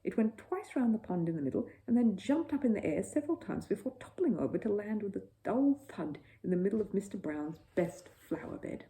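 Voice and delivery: ordinary delivery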